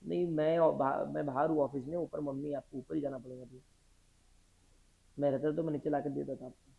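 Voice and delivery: low, soft private phone voice; hushed phone aside. Real, not acted. Teacher on phone telling family member to go upstairs, hushed. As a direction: drop low and quiet for a personal call. Takes phone call during class.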